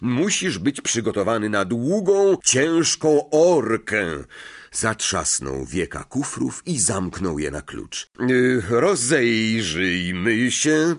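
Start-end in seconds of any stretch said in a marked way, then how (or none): 0.0-4.2 high, emphatic, stretched-out lecturing; pompous Lockhart, boastful. A narrator's reading. 8.3-10.9 slow, louder, drawn-out musing; pondering aloud. A narrator's reading. Lockhart pondering while packing.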